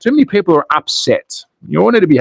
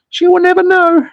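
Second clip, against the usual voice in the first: very high, playful falsetto; singsong high mock-sneaky voice; mock secretive glee; 14 semitones higher than usual. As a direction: squeak it out high, like a cheeky secret. Sneaky joke about wife's lint remover.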